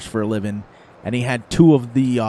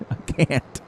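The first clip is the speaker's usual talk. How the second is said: ordinary talk, nothing marked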